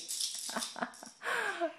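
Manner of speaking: squealing happy laugh; high delighted laugh. Real, not acted. Delighted by baby.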